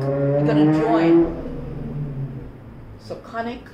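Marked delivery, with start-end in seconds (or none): 0.0-2.6 slow, stretched and louder join; drawn out. Shown as a demonstration. Teacher stressing the word for learners, drawn out slow. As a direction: draw the word join out long and strong. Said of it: "Yeah, you're gonna connect".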